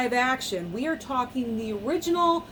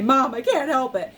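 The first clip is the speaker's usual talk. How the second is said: louder, pleading protest; 3 semitones higher, 5 dB louder than usual. Retold or imitated. Telling mom she can't stop crying, louder plea. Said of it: "And I say".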